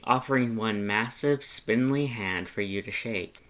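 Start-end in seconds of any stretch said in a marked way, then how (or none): none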